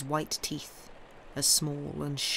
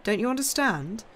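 higher, emphatic challenge; impassioned questioning; 6 semitones higher, 4 dB louder than usual. A narrator's reading. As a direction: press him with the question. Count exclaims at Franz's question.

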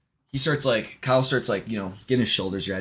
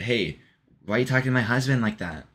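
quick, higher-pitched challenge; acting a wife defending her husband; mock-indignant, rapid question; confronting; 4 semitones higher than usual. Retold mother confronts the joke-teller.

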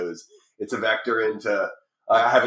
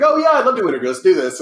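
eager; 10 semitones higher, 7 dB louder, faster than usual. Retold or imitated. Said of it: "I was like".

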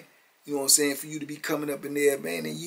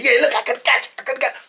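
playful taunt; 11 semitones higher, 9 dB louder, much faster than usual. Retold or imitated. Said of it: "He said".

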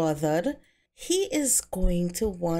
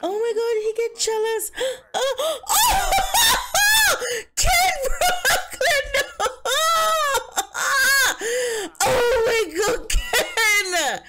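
shrieking with laughter, much higher and louder; ecstatic shrieking; 5 dB louder, slower than usual. Real, not acted. Viewer thrilled by Ken Brooklyn's compliment, screaming with laughter. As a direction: squeal and laugh out of sheer delight.

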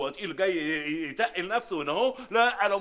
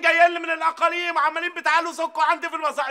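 high, loud yelling; furious shout; 8 semitones higher, 5 dB louder than usual. Acted. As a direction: bellow at both of them. Adel yells at both merchants.

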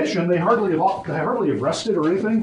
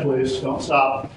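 ordinary delivery

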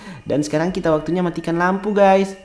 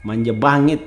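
normal talking voice

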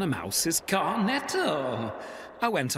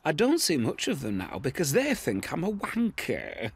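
normal talking voice

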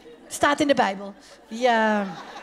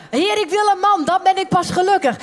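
pleading prayer; 7 semitones higher, 4 dB louder than usual. Acted. Acting woman begging for husband.

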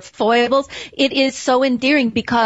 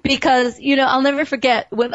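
ordinary talk, nothing marked

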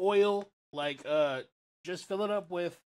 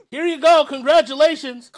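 much higher, loud sarcastic salesman; sarcastic seller; 10 semitones higher, 16 dB louder than usual. Pretend. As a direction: shout it like a smug seller handing over air. Mocking the oxygen seller.

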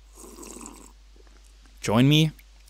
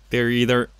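normal talking voice

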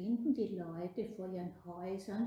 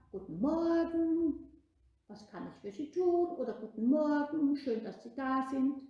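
friendly greeting demo; 5 semitones higher, 6 dB louder than usual. Shown as a demonstration. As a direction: show how "Guten Morgen" sounds kind and welcoming. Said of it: "Du musst sagen". (freundlich vorgemacht)

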